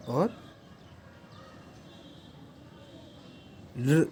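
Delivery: each vowel drawn out alone, long pauses between; vowels said one by one. Shown as a demonstration. Teacher slowly sounding out the Sanskrit vowels. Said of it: "वे पांच स्वर हैं".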